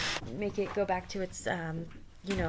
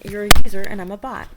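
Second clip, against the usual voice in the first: shout; 22 dB louder, faster than usual. Acted.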